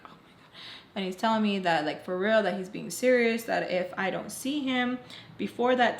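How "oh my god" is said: breathless whispered oh my God; barely voiced, panicked under her breath. Girl panicking over boyfriend's threat, whispered.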